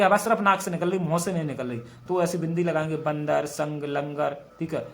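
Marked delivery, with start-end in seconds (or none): none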